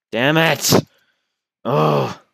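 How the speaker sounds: loud, high frustrated yell; frustrated outburst. Real, not acted. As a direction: shout it out as the game goes wrong. Frustrated in-game death.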